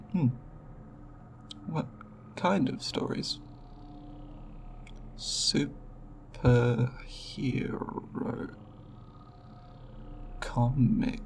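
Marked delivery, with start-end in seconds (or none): 5.2-11.2 slow, hesitant, word by word; soft, halting repetition of foreign words; sounding out. Acted. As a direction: sound out an unfamiliar term carefully. Alien repeats unfamiliar human term.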